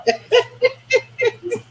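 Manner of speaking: loud, high-pitched cackle that tips into crying; helpless laughter; wheezing laugh, near tears; high squealing laugh. Real, not acted. Laughing at own story.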